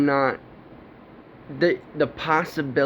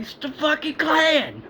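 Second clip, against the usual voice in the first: much higher, frantic yelled alarm; retold scream; 12 semitones higher than usual. Yelling to neighbours at four a.m., high alarmed shriek. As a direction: shriek it out to wake the whole neighbourhood. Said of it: "I'm screaming at all the".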